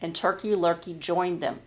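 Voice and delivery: ordinary talk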